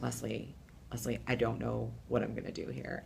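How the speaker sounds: soft, low hushed plea; quiet worried plea under the stage. Retold or imitated. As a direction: murmur it so no one on set hears.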